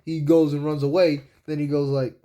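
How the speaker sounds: ordinary delivery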